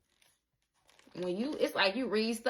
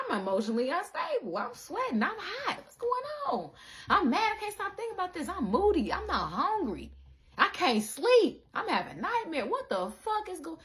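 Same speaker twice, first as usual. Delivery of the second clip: high, rising, panicky rant; high-pitched overwhelmed whine; frantic mock panic; 9 semitones higher than usual. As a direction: play someone coming apart at the seams. They list symptoms, acted panic.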